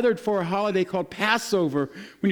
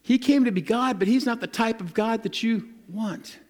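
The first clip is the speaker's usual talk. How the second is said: ordinary delivery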